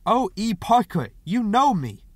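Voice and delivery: high, loud insistence; loud, exasperated calling; insistent call. A narrator's reading. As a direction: press them to recognise you through the door. Insists through shut door.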